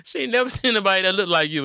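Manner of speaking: ordinary delivery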